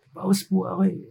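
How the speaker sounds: softly faked hoarse excuse; mock hoarse voice. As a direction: fake a hoarse throat, quietly. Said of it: "biglang magiging paus yung boses".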